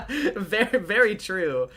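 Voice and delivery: high, loud agreement through real laughter; laughing. Real, not acted. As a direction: crack up while agreeing. Cracking up at own complaint.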